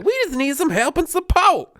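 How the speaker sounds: put-on high, loud child's voice; little kid. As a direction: imitate an animated kid pleading on the news. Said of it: "The kid's just like".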